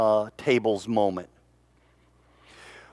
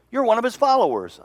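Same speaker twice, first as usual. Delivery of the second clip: sharp accusation; 11 semitones higher, 4 dB louder, faster than usual. Acted. Accusers question Peter in crowd.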